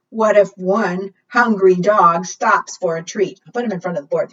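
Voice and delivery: plain reading voice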